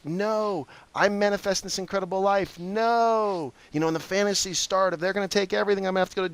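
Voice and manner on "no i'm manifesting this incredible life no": higher, a lightly voiced panicked refusal; mock denial. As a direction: push the bad news away. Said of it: "And I'm like".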